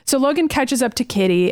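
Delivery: usual speaking voice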